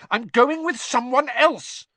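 high, loud, furious insistence; very angry. Acted. Hermione telling Ron she has a partner, very angrily. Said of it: "Hermione said very angrily".

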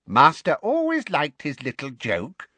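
high, awed house-elf voice; creaky little elf, wondering aloud; Kreacher. A narrator's reading.

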